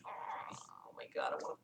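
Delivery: breathless whispered aside. Real, not acted. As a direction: whisper it in despair. Overwhelmed by the story.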